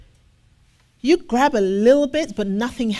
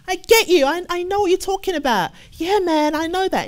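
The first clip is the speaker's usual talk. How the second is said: much higher easygoing "I hear you, man"; mock casual agreement; 8 semitones higher, 4 dB louder than usual. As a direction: brush him off with breezy promises. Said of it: "You said you understand".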